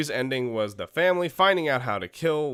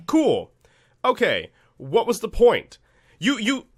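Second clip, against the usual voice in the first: high-pitched, incredulous complaint; exasperated; 10 semitones higher, 5 dB louder, slower than usual. Real, not acted. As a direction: sputter in exasperation, pitch jumping up. Mocks a pointless jump scare.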